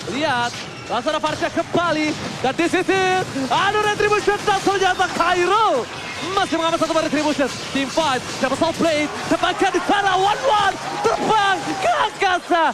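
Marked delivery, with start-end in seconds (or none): 2.4-12.6 loud, hyped esports casting; excited play-by-play. Acted. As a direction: call the action at full throttle. (komentator esports yang berteriak heboh)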